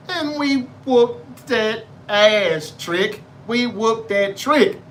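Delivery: slow, drawn-out wrestler catchphrase; Trick Williams impression. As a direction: stretch it out like a wrestling promo. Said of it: "in my Trick Williams voice".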